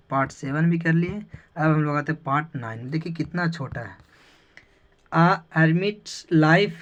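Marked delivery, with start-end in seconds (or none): 5.2-6.7 very slow, each word set apart; slow example. Shown as a demonstration. Teacher reads the example slowly to the class. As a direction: spell the phrase out slowly for the class. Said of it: "ये भाई गलत है".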